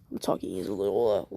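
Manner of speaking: ordinary delivery, nothing marked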